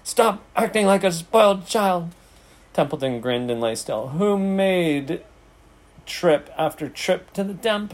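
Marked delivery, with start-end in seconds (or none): 0.0-2.1 high, exasperated scolding; screamed. A narrator's reading. Pig scolding the idle rat, screaming. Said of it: "screamed Wilbur". 4.1-7.9 higher, aggrieved rhetorical question; taunting. A narrator's reading. Rat listing his services to pig, taunting.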